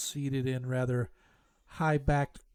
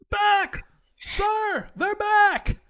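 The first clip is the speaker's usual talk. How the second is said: high, loud panicked shout; yelling guard; 10 dB louder, much slower than usual. Acted. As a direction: yell it out like an alarmed guard. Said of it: "begins yelling".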